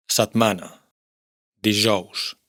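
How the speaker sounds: "Satmana, dijous" con ese sorda como en castellano. Shown as a demonstration. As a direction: pronuncia la ese de "Satmana, dijous" sin voz. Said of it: "se pronuncia igual que en castellano". (ese sorda catalana)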